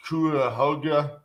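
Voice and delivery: Cuyahoga slowly stumbled as "KOO-uh-HO-guh"; halting mispronunciation. Retold or imitated. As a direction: sound out Cuyahoga wrongly, unsure, as "koo-uh-hoga". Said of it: "this is where I get on the phone".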